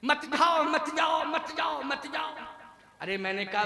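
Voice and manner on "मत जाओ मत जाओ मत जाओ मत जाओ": high, repeated mock plea, fading away; lightly voiced wife begging over and over; pleading wife. Wife at dying husband's bedside, lightly acted pleading. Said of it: "तो पत्नी कह रही थी".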